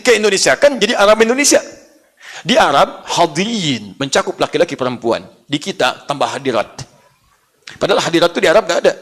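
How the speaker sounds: ordinary talk, nothing marked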